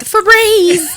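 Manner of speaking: mock disgusted call. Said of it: "people are like".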